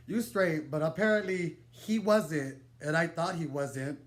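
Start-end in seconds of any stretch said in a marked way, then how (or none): none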